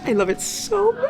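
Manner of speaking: high, squealing gush of delight; swooning high-pitched squeal; excited squeal. Real, not acted. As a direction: squeal it like a fangirl. Gushing about a romantic scene.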